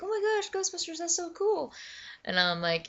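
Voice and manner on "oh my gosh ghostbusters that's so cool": very high, excited put-on voice; imitated gushing woman. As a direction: gush like a thrilled colleague. Voicing hiring gal's delight.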